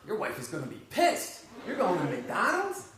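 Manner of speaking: can't believe what he just heard; shocked disbelief. Retold or imitated. Overhearing the patient's plan, in loud shocked disbelief. Speaker's shocked inner reaction.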